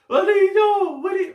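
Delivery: very high, loud taunting shout; mocking captain. Imitating the captain taunting the fisherman. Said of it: "he's just speaking in Spanish".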